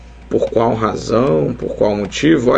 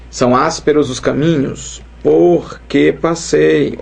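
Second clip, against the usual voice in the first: slow dictation; 3 semitones higher, slower than usual. Shown as a demonstration. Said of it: "É ou não é, ó?".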